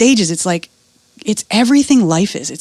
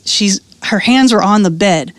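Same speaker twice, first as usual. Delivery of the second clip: usual speaking voice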